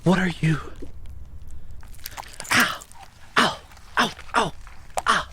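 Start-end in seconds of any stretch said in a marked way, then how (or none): 2.5-5.3 cries of pain. Acted. Burned by the sticky glue.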